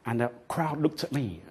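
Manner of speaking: ordinary talk, nothing marked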